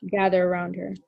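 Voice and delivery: ordinary talk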